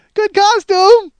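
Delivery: very high, loud, drawn-out compliment; hammed-up wife. Husband mocks his wife's compliment, high, slow and loud. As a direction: stretch it out, mocking her. Mocking wife once more.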